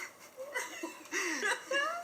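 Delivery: high laugh breaking out of the reading; can't hold it in, laugh high; burst of real laughter. Real, not acted.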